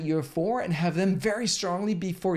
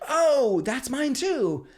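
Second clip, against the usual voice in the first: excited recognition; 7 semitones higher, 3 dB louder, much slower than usual. Retold or imitated. People drawn to the vibe.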